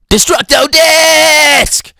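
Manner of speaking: high, loud, drawn-out battle shout; hammed-up attack shout; anime attack call. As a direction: yell the move, holding the last word. Imitates Krillin's attack call.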